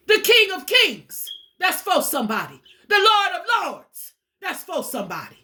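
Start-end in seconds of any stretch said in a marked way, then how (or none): 0.1-1.3 rising preacher's call, higher and louder; preaching proclamation. Real, not acted. Preacher exalting the Savior to listeners, raised proclamation. 2.9-4.1 slow, higher preacher's call; slow preaching proclamation. Real, not acted. Preacher exalting the Savior to listeners, slow raised proclamation.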